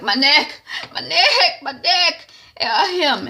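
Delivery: high, loud, pained whining; pained whimper. Retold or imitated. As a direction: whine in pain about the neck. Hurt woman groaning about neck.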